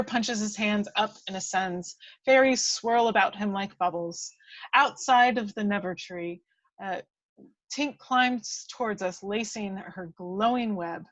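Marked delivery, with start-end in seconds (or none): none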